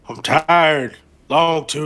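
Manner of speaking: "I'm tired" yelled high and loud, voice breaking; exhausted vampire shouting "I'm tired" at his friend; anguished shout. Acted. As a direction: cry "I'm tired" out at full volume.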